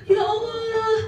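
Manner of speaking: high, crying plea for water; wailing sinner. Acted. Teacher acting a sinner in hell, wailing for water. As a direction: cry out like a parched soul begging God.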